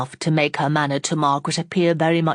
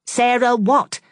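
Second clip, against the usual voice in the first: high and louder, snapping the question; sharp; 8 semitones higher, 5 dB louder, slower than usual. Acted. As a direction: cut in sharply on "what". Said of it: "asked Fanny sharply".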